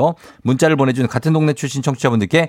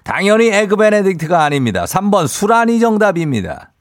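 game show host; 7 semitones higher than usual. Acted. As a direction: tease the wrong answer, then reveal. Host reveals the answer with suspense.

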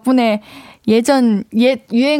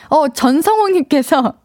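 ordinary delivery

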